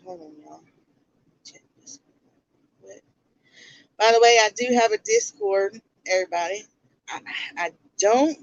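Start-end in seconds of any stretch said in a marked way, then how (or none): 0.1-3.0 breathy aside whisper to the room. Real, not acted. Streamer whispering an aside to someone off mic. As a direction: drop to a whisper for a quick aside.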